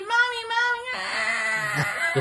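put-on high, very slow drawn-out child's wail; imitating a kid wailing for mom; screaming kid. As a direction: stretch out Mommy like a kid howling. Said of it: "I heard this kid".

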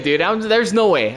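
usual speaking voice

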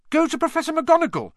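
high, loud and fast urging. A narrator's reading. Hermione urges Neville to report Malfoy, urgently. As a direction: push him to go, urgently. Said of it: "Hermione urged Neville".

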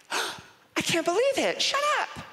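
very high, gasping disbelief; hammed-up girly astonishment; scandalised girl. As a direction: gasp and squeal like a shocked roommate. Roommate reacts to dorm confession.

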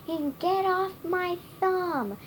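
high-pitched yelled protest; yelling. A narrator's reading. Funny little man yells at the boys who grabbed him. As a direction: raise the pitch for a storybook yell. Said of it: "yelled the funny little man".

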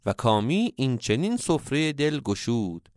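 ordinary delivery

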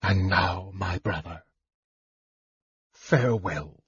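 low, hushed parting; quiet farewell. A narrator's reading. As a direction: say goodbye softly, letting it drop away. Brother's cold parting words in narrated story.

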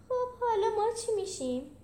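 high, anxious little voice; worried high-pitched question; scared. A narrator's reading. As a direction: ask it nervously, pitched well up.

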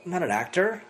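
ordinary talk

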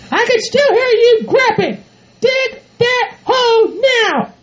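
high falsetto, drawn-out order; screaming bossy partner. Acted. Bride hearing groom grunt as he digs, screamed order. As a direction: scold in a high, piercing put-on voice. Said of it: "You don't have to scream at me".

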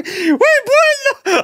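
high, slow pained wail; crying in pain. Retold or imitated. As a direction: whimper it like a hurt kid. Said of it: "он плачет, такой".

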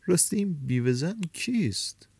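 high, would-be casual question; feigned indifference. A narrator's reading. Man asks café owner about the widow, feigned indifference. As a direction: ask lightly, as if it hardly matters.

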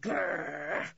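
rolled, growled dog noise; dog's growl. A narrator's reading. Timmy warns the couple off, growling. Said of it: "said Timmy".

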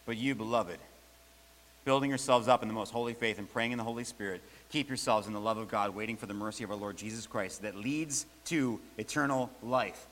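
soft, reverent reread of the verse; quiet reverent reading. Shown as a demonstration. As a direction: read it hushed, as a benediction. Verse reread after the story.

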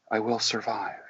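very soft, meek attempt; small, hushed voice; timid. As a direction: imitate a reluctant client. Acting the timid client.

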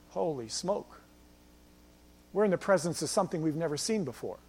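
soft, low hushed amazement; hushed awe. Acted. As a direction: voice the stunned witnesses, dropping right down. Disciples awed by miracle.

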